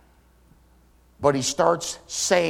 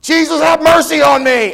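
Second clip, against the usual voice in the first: much higher, much louder cry for mercy; desperate shout; 11 semitones higher, 10 dB louder than usual. Retold or imitated. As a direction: yell it over the crowd. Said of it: "He kept right on yakking".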